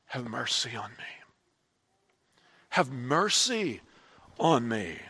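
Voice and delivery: soft, hushed cry for mercy; quiet plea. Acted. Christians crying to Jesus, hushed and soft. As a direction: murmur it like a private prayer.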